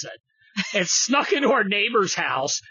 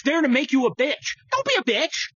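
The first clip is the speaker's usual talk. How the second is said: 1 rising rant; 12 semitones higher than usual. Real, not acted. Ranting building to climax.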